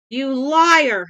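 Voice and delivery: furious shout; screaming. Acted. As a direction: scream the accusation at full force. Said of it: "Hex screamed".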